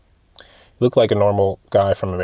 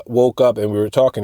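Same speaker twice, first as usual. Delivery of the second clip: usual speaking voice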